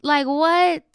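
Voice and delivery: high, loud disbelief; who does he think he is; incredulous. Acted. Reacting to the boyfriend bossing her: high incredulous outrage.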